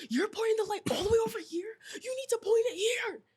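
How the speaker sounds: imitating the boss yelling at her about the light; mock angry yell. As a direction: bark the correction like the bossy boss. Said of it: "And he's yelling at me".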